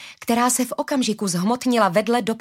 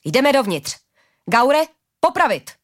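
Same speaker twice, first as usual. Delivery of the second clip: raised voice giving orders; commanding; 5 semitones higher, 4 dB louder than usual. A narrator's reading. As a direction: order your men in, forcefully. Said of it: "přikázal Suf".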